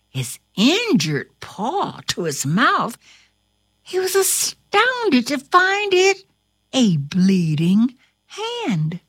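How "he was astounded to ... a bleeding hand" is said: plain reading voice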